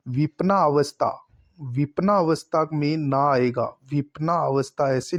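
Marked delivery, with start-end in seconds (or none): none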